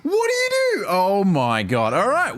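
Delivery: very high, loud outburst; exasperated yell. Real, not acted. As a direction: blurt it out at the screen. Gamer exasperated at game.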